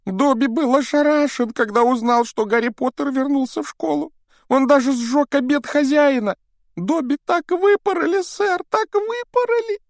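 high, squeaky pleading elf; house-elf voice. Acted. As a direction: play Dobby the anxious house-elf. Dobby laments punishment. (тонкий голос домового эльфа)

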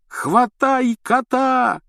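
high, loud, very slow roar. A narrator's reading. Рон ревёт, чтобы кто-то схватил кота, во весь голос. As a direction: bellow across the room. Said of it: "взревел Рон".

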